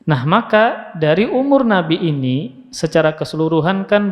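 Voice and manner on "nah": ordinary talk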